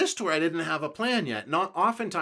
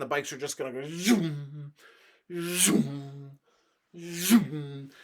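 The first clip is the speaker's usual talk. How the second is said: bikes zooming past; much slower than usual. Retold or imitated. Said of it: "bikes going by".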